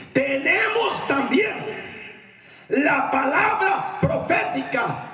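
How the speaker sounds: very high, stretched-out cry; emphatic proclamation. Acted. Preacher proclaims the key verse, high slow cry. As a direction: proclaim each word slowly at high pitch.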